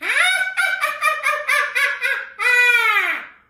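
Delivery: long, high, loud cackle; witch cackle. Acted. Voice teacher demonstrating the cackle, high and piercing. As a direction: let rip a shrieking witch laugh. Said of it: "The cackle".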